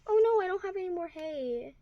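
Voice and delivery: lightly dramatic worry over missing supplies; mild dismay. Pretend. Discovers the hay has run out.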